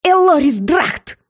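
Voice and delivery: high, drawn-out furious exclamation; blurted out angrily. A narrator's reading. Seer flaring up at the shapeshifter, high angry outburst. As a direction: hurl the name out slowly, pitched high. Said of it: "выпалила Сандрия, сверкая глазами".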